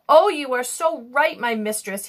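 ordinary delivery

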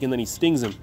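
usual speaking voice

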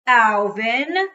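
loud, high bellowed name. A narrator's reading. Furious dad summoning his son, loud bellow. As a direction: bellow it out, stretching up at the end. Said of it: "my dad bellowed".

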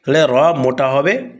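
normal talking voice

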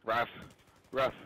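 spoken "ruff" like a dog; joking dog-accent yaps; dog bark. Pretend. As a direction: do a short pretend dog bark. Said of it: "I can do a dog accent".

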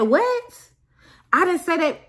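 high, incredulous what; disbelieving high protest; stunned disbelief. Retold or imitated. Retold disbelief at accusation.

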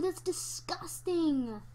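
ordinary talk

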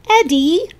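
high, loud scolding squeal; squealed reproach. A narrator's reading. Lisa scolds Eddie for being rude, squealing. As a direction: squeal the name in shock. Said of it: "Lisa squealed".

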